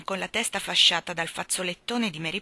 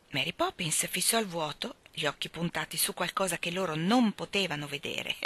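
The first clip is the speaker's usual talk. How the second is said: plain reading voice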